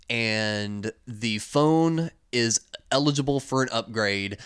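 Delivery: normal talking voice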